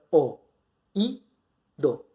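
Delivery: "o, i, do" sílaba por sílaba; separado en sílabas. Shown as a demonstration. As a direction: deja una pausa clara entre "o", "i" y "do". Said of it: "la forma correcta de separar en sílabas". (pronuncia "o", "i", "do" como tres golpes separados)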